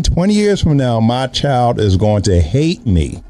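ordinary talk

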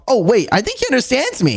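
ordinary talk, nothing marked

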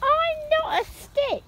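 high, slow, soft protest; high plaintive little stick voice; indignant Stickman. Acted. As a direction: squeak out his hurt insistence.

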